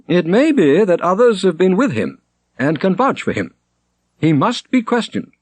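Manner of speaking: high, loud pronouncement; a prior claiming authority; decreeing prior. A narrator's reading. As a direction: lay down the law.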